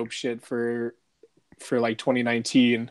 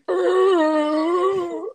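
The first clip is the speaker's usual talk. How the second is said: high, loud undead snarl; zombie roar; 4 dB louder than usual. Retold or imitated. Joking about the reanimated Ned greeting Jon with a snarl. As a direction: let out a monster's growl. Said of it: "and he's like".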